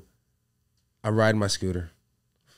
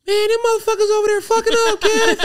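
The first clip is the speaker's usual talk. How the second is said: much higher, louder excited mom; mom's voice; 8 dB louder than usual. Retold or imitated. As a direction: play the mom grabbing the phone. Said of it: "my mom snatched the phone".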